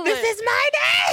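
high, loud, drawn-out mock demand; mock bridezilla shout. Joking with friend as a bridezilla, mock shouting. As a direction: yell it like a spoiled bride claiming the day.

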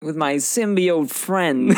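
slow, drawn-out mock accent; Christopher Walken. Co-host topping the impression, slow and drawn out. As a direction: stretch the words in a joking impression. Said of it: "like a young Christopher Walken".

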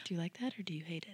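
fast, hushed teasing whisper; playful whisper. Real, not acted. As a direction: lean in and whisper the question quickly. Teasing question to co-host.

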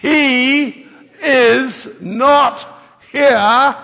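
slow, loud, drawn-out proclamation; loud, slow Easter proclamation; proclaimed together. Shown as a demonstration. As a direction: ring it out to the whole church, slowly. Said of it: "Let's say it together".

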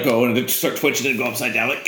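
usual speaking voice

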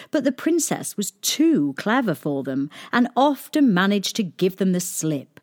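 plain reading voice

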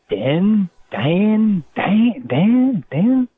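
mock confused listener mishearing the name. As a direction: repeat the name questioningly, climbing each time. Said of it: "People would be like".

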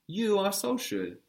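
"You" pitched high and emphasized; stress on YOU. Shown as a demonstration. Teacher gives student the next phrase, high stress on "You". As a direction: lift and lean on "You". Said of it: "Again, with emphasis".

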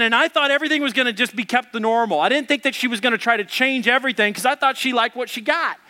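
ordinary delivery

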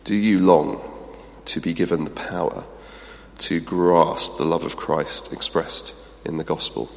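guided pause before prayer; quiet reflection. Acted. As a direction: invite them gently into stillness.

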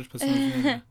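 call dropping out. Pretend. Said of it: "ça capte mal".

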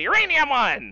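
loud, high mock pundit yell; Hannity impression. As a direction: shout it like a TV pundit. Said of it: "Lumpy will come on and say".

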